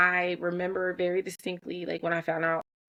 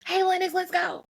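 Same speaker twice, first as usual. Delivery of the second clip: high, mock little-boy yell at his sister; a kid's pitched-up yell down the hall; imitating son yelling; 11 semitones higher than usual. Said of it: "Xander yells to Lennox".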